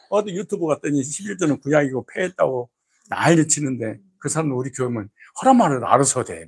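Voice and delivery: normal talking voice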